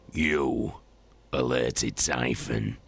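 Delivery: low slow menacing accusation; growling. Acted. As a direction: lean on each word, low. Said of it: "growled Garrow".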